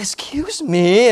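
slow, loud, incredulous Excuse me with a laugh; mock disbelief. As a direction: draw it out in disbelief. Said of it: "And I was like".

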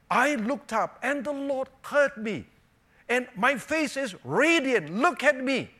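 loud, high-pitched joyful declaration; acted-out exultant witness; jubilant testimony. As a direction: proclaim it proudly to everyone listening.

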